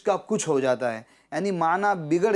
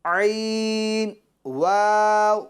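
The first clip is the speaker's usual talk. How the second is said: very slow, drawn-out chant on a raised pitch; spelling drill; 3 semitones higher, 3 dB louder, much slower than usual. Shown as a demonstration. Teacher leading children to repeat, drawn-out spelling chant. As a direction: stretch each letter name for the class.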